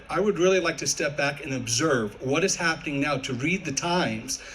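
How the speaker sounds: ordinary talk, nothing marked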